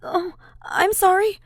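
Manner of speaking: high, loud flustered apology; flustered exclaim. Acted. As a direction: blurt out an embarrassed sorry. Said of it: "Ash exclaims".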